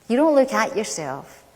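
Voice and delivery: normal talking voice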